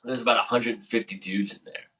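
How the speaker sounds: ordinary talk, nothing marked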